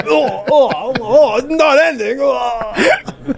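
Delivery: high, strained mock alarm; hammed-up panic. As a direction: play the endless gag in fake panic. Acting out endless mouth gag.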